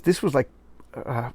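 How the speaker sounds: normal talking voice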